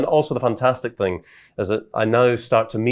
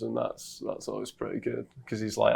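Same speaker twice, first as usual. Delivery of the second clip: normal talking voice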